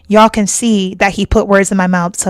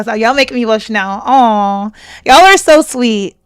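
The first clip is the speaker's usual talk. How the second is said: ordinary talk, nothing marked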